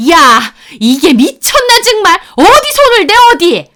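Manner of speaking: loud, high enraged mother-in-law; trembling-with-rage scolding. Acted. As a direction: yell in outrage, pitch soaring. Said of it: "온몸을 부들거리면서 저한테 화를 내시는".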